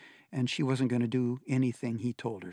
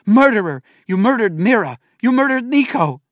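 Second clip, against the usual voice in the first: furious scream, much higher and louder; 10 semitones higher, 14 dB louder than usual. A narrator's reading. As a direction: scream it with everything, through tears.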